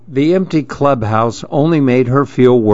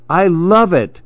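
delighted; 7 semitones higher than usual. A narrator's reading. Story character delighted by a gift.

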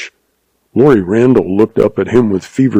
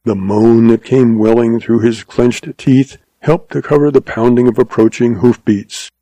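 ordinary delivery

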